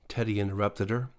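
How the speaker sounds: ordinary delivery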